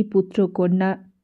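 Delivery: usual speaking voice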